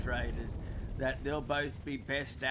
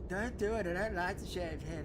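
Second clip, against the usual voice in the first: higher-pitched mock wife voice; husband mimics his wife objecting to the head shave, higher; imitating wife; 5 semitones higher than usual. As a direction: whine it up high like his wife begging. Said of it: "My wife doesn't want me to shave my head".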